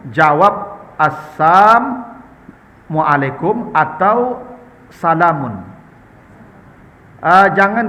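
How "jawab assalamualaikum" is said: assalamualaikum spoken slowly, syllable by syllable; slow teaching demo; slow taught reply. Shown as a demonstration. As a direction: spell out assalamualaikum slowly for the congregation.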